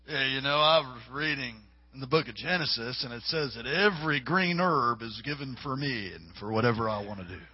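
lazy stoner. Retold or imitated. Stoner quotes Genesis to the preacher, in a low dopey voice. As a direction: play the stoner excusing weed. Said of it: "I had this guy say to me".

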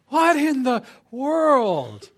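high, loud, drawn-out disbelief; slow incredulous yell, hammed up; exasperated parent. Parent turning back to the sprawled-out child, exasperated shout. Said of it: "exasperation that every parent understands".

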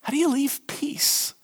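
hushed, wondering whisper; a puzzled whisper to the congregation; whispered question. Acted. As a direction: lean in and whisper it. Preacher wonders aloud.

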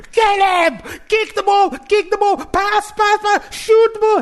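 high, loud yelling from the sideline; sideline parent yelling. Retold or imitated. Dad on the sideline yelling at his son. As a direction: bark orders at your kid on the pitch. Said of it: "so I'm that parent".

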